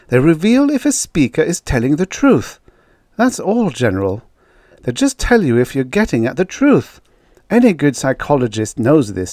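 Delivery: earnest woman researcher restraining her anger; forced-down anger. A narrator's reading. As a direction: explain while holding the temper back. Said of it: "She forced down the anger".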